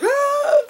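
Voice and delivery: high shrieked mock scream of shock; a sudden high mock scream. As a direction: shriek like you woke up sliced open. Guy wakes to cut stomach.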